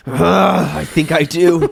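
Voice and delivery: slow, loud, exaggerated sass; drawn-out sassy comeback; sassy retort. Mocking reply to read-aloud quote.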